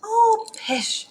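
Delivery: high-pitched motherly brush-off; light, high pooh-pooh; dismissive mother. A narrator's reading. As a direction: wave away a child's worry in a high voice. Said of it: "said her mother".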